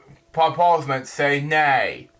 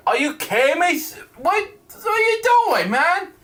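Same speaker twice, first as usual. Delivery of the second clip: much higher, incredulous yelp; exasperated outburst; 13 semitones higher than usual. Real, not acted. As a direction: shoot up in disbelief at what you just read. Reader exasperated by the script.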